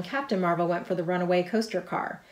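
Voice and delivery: usual speaking voice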